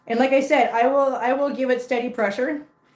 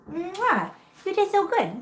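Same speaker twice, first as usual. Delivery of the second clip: overwhelmed by cuteness; 8 semitones higher, slower than usual. Real, not acted. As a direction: gush over the tiny kitten. Kissing and praising the kitten.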